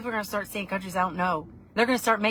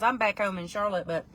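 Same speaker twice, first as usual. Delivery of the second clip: ordinary talk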